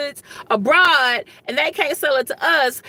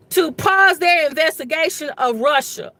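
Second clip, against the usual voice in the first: usual speaking voice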